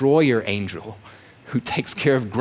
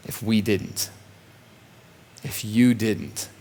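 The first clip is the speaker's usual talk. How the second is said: ordinary delivery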